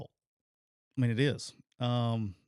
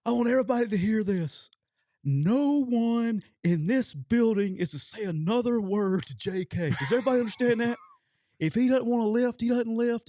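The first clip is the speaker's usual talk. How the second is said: furious coach's decree; 11 semitones higher, 7 dB louder than usual. Retold or imitated. Head coach orders staff to leave punter alone, retold furious shout. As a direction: lay down the law to the whole room, voice raised.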